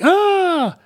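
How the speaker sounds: high, startled little yelp; mock frightened yelp. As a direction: squeak in fake fright. Frightened Belgian acted out.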